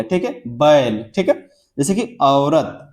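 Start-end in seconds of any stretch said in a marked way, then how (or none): 0.5-1.0 बैल slowly glided as ba-el; combined vowel. Shown as a demonstration. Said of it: "फिर ए हो गया". 2.2-2.9 औरत slowly, gliding from A into O; combined vowel. Shown as a demonstration. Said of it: "आ से शुरू किया और फिर ओ".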